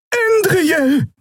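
very high cry of the name; alarmed call. A narrator's reading. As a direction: call out to a friend in danger. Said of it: "rief Xia". (hoher, alarmierter Ruf)

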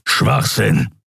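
rough, dismissive growl; grumbled scorn. A narrator's reading. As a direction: growl it with contempt. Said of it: "grollte Keru".

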